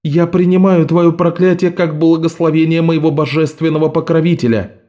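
high, proud acceptance; defiant girl. A narrator's reading. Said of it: "ответила Наска".

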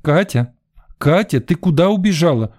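put-on high-pitched call for a child; mother calling out. A narrator's reading. Mother looks for runaway daughter, calling high. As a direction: call the name across the beach.

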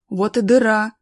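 voiced character's hearty call; pointing out a find. A narrator's reading. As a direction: call it out to the group behind. Pointing out the cave entrance.

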